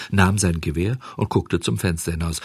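usual speaking voice

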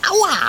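loud, high yelp of pain; pained ouch. Acted. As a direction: yelp as the fur is tugged. Koala pulls his fur. (lauter Schmerzensschrei)

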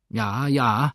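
loud, amused agreement in character voice; chuckling to himself. A narrator's reading. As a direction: say it as if chuckling to yourself. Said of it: "lachte der Neger vor sich hin".

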